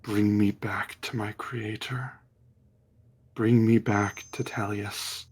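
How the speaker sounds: soft, low hushed plea of a sword; quiet whispering sword voice. Acted. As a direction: murmur it like a sentient blade. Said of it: "the sword whispers to Euphemion".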